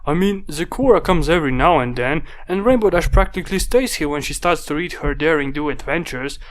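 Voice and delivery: a hint of a boyish little dragon, chatty; lightly voiced young dragon. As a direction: muse aloud as a small helper. Spike character voice in story.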